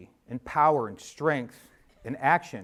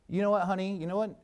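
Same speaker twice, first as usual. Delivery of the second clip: lightly voiced wife, pitched higher and gentle; a hint of Maria's knowing, affectionate tone; quoting his wife with a knowing lift; imitating his wife; 6 semitones higher, faster than usual. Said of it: "when Maria talks like this".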